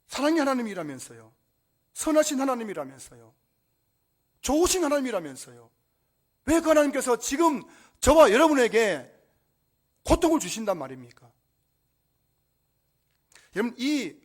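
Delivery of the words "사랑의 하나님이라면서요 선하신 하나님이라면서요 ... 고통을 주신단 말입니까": loud, raised protest, building to a peak; indignant challenge. Acted. Preacher challenging God's goodness over suffering, loud indignant protest. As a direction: throw each claim back as an accusation.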